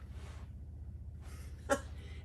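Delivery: quiet unvoiced chuckle; a soft airy laugh at the absurdity; breathy laugh. Real, not acted. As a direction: silent wheeze of a laugh. Laughs at the shocking headline.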